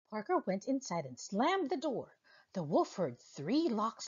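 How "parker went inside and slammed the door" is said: plain reading voice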